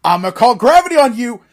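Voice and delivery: high, loud trash-talk yell; imitating a wrestler's ringside yelling; Xavier yelling out. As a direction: shout it high and hard, taunting an opponent. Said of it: "Xavier just starts yelling out".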